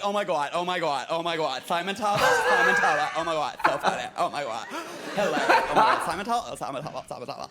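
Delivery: hammed-up gushing American fangirl; overexcited American. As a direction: gush breathlessly in a broad American accent. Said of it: "as overly excited and, like, enthusiastic as they come. Totally American".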